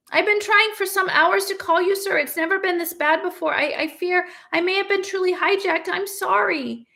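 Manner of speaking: hint of a fussy young student; a ghostly student calling out to his professor; lightly voiced student. A narrator's reading.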